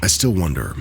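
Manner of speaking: plain reading voice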